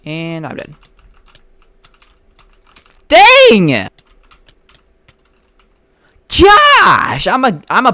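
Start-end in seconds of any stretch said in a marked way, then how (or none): 3.1-3.9 loud, high burst of frustration; frustrated shout. Real, not acted. Gamer yelling at his own death in the game, real frustrated shout. 6.3-7.9 high, loud exasperated cry; exasperated yell. Real, not acted. Gamer crying out after dying again, loud exasperated yell. Game death, frustrated outburst.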